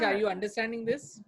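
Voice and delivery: ordinary talk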